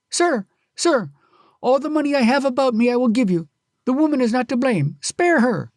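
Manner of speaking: high, loud, hurried plea; desperate pleading. Acted. Kyusuke begging the husband to spare his wife, desperate. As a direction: beg him to stop.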